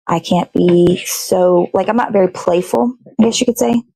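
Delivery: ordinary talk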